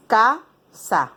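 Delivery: ca-sa, each syllable said apart; split into syllables. Shown as a demonstration. Teacher to pupils, showing the syllables, said in beats. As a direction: chop ca-sa into two beats. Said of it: "La separamos en sílabas".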